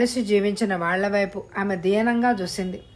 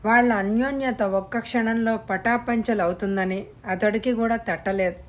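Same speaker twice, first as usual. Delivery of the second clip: ordinary delivery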